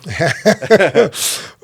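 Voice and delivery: bright burst of real laughter; a real laugh before answering. Real, not acted. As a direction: laugh out loud at the memory. Laughing at own wild youth.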